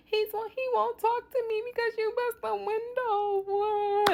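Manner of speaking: mock whiny friend. Teller mimics her friend calling her extra, in a high whine. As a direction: make fun of the friend's fuss.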